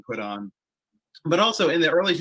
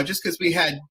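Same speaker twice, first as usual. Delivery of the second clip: ordinary talk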